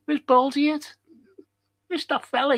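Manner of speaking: a hint of a fussy clerk hunting for someone; mock airline desk staff. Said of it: "Could you imagine them saying".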